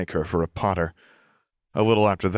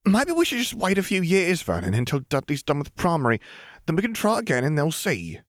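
much higher, a fussy anxious wife; Aunt Petunia; 10 semitones higher than usual. Acted. Petunia soothing angry Vernon, voiced much higher and fretful. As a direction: coax your husband, higher and pinched.

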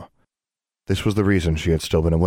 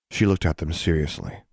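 plain reading voice

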